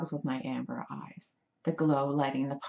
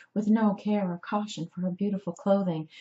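usual speaking voice

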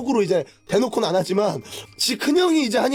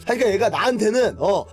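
ordinary talk

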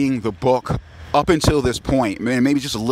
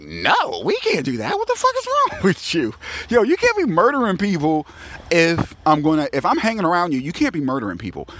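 higher-pitched mock protest; retold indignant objection; 7 semitones higher than usual. Woman objects to his murdering people, indignant. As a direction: act out an exasperated telling-off.

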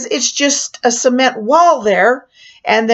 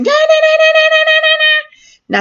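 rapid high "da da da" mimicking frantic presses, much higher; frantic button pressing; 4 dB louder than usual. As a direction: hammer out each "da" high and loud like jabbing a button.

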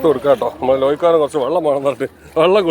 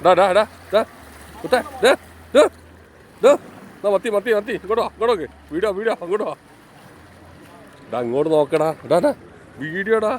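calling out; 5 semitones higher than usual. Real, not acted. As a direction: shout to get someone's attention. ദൂരെയുള്ള ആളെ വിളിക്കുന്നു. (ഉറക്കെ വിളിക്കുന്നു)

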